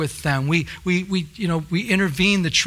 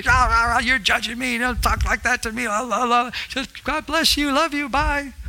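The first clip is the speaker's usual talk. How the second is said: mock angry younger self; 6 semitones higher than usual. Retelling how he yelled at his sister's scripture, high mock rant. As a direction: ham up the brushed-off brother. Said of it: "And I'd be".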